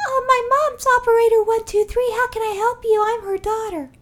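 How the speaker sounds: put-on high, childish operator voice; little girl voice. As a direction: chirp like a small girl on the phone. Kid answering 911, high.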